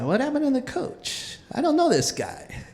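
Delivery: high, soft puzzled wondering. Retold or imitated. Kid wondering at the suddenly nice coach, puzzled. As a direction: imitate a baffled kid thinking aloud.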